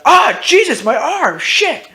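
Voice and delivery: high, loud yelp of pain; pained cry. Acted. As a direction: cry out as the bullet hits your arm. Shot in the arm, crying out.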